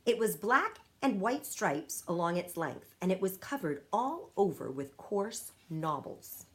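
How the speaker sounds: usual speaking voice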